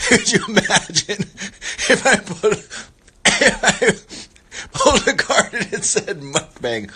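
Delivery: high, loud squeal through laughter; helpless laughter. Real, not acted. Vlogger imagining a mukbang card, cracking up while talking. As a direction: barely get the words out for laughing.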